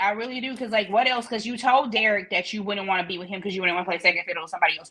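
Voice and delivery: ordinary delivery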